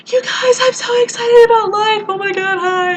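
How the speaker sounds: very high, loud mock squeal; squealing excitement. Student being noisy outside with friends, high excited squeal. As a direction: shriek it like an overexcited partygoer. Hypocritically noisy three nights later.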